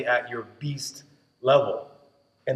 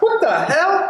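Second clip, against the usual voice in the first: high-pitched mock disbelief, laughing; shocked reaction; 14 semitones higher, 3 dB louder, much slower than usual. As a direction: yelp it out high, half laughing. Acting out startled reaction to grabbing crowds.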